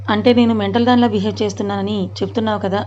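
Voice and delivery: usual speaking voice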